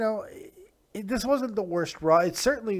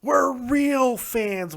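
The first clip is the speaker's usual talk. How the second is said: slow, higher mock of a smug fan; slow sarcastic parroting; mocking quote; 7 semitones higher, 4 dB louder, much slower than usual. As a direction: sneer it out like a snob. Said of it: "I hate when you use the defense".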